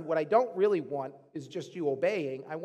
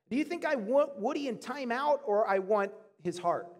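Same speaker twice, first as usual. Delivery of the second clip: normal talking voice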